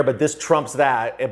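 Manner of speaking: normal talking voice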